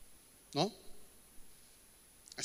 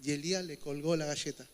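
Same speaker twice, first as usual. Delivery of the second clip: ordinary delivery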